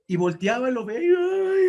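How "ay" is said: loud, high, drawn-out "ay"; hammed-up wail of someone bawling; mock crying wail. Satan bawls before his demons, mock crying wail. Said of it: "otra vez empezó a llorar".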